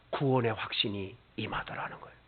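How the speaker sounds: hushed whispered confession. Acted. Preacher telling the congregation of assurance, whispered. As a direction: let the words fall to a breath.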